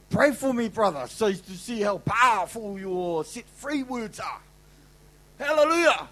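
loud, high, fervent sermon delivery; preaching at full force. Acted. Preacher exhorting the convention crowd, full force. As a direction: drive it home to the congregation.

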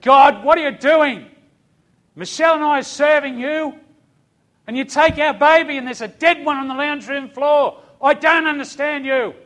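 loud, high-pitched, anguished challenge; yelling at God. Retold or imitated. Preacher re-enacting his confrontation with God, yelling. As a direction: raise the voice hard and demand answers. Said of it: "and I yelled at God".

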